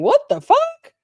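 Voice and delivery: high, loud screech of disbelief; screaming. Acted. Streamer screaming for the whole chat, high-pitched scream. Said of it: "I think we're all screaming".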